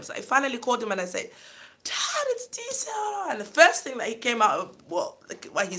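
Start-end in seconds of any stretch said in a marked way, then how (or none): 1.8-3.3 retold small, much higher, slow hello to her father; timid little voice. Daughter calling her long-silent father in a tiny voice. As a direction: shrink into a tiny child's voice on the phone.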